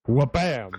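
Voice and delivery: vocal door slam, a quick bang. Pretend. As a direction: bark out a door slam noise. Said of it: "make that A O L door slam sound".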